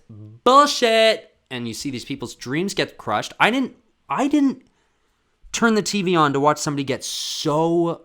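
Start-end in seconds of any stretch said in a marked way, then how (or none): 0.1-1.4 outraged yell. Real, not acted. Vlogger ranting at the Olympic rules, outraged yell. 3.4-8.0 high, loud indignant outburst; indignant yell. Real, not acted. Vlogger venting about athletes' crushed dreams, indignant yell.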